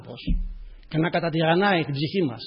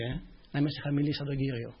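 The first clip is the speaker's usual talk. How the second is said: normal talking voice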